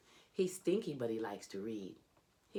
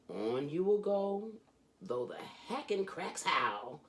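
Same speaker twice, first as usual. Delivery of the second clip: lively, sing-song reading of a rhyming picture book; animated children's book read-aloud; storybook reading; 2 semitones higher, slower than usual. Acted. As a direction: read it like a bedtime story for a kid. Reads Dr. Seuss aloud.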